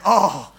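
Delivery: loud mock groan; hammed-up achy groan; pained groan. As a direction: groan loudly like a sore big baby. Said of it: "I'm groaning".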